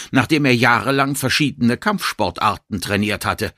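ordinary delivery

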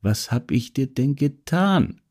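a soft, worried repeat of the question; hushed, helpless question; quiet pleading. Acted. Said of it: "fragte er noch einmal".

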